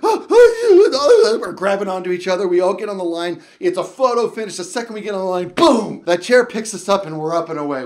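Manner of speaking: loud, breathless excitement; loud, thrilled, gasping retelling; excited rush. Retold or imitated. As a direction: tell it like a photo finish. Frantic boarding of chair lift.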